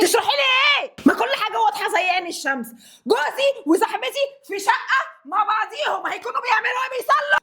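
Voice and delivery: rip into them with sarcasm; wife mocking the cheating pair, high and furious. Wife confronting husband and friend.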